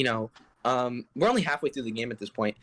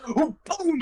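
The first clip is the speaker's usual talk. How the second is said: skull's sudden cry; 12 semitones higher, 3 dB louder than usual. Retold or imitated. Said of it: "the skull came to life and was like".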